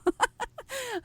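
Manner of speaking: high giggle; real laugh. Real, not acted. Laughs at own cheer.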